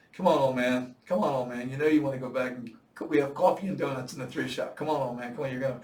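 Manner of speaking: acted-out heckling from the boat; loud mock urging of a struggling swimmer. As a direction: call out loudly, egging him on. Acted taunts from safety boat crew.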